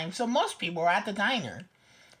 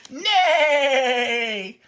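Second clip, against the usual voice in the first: loud neigh; horse neigh; 7 dB louder than usual. A narrator's reading. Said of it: "said Horse".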